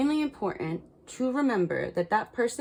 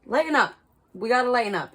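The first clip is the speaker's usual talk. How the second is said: cheerful push; 5 semitones higher, 6 dB louder, faster than usual. Real, not acted. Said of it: "urging herself to lighten up".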